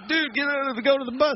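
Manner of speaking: high, panicky hurry-up; frantic urging. Retold or imitated. Teller to oblivious photographer behind him, frantic. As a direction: shoo him away urgently, voice jumping up. Urgently shooing friend away.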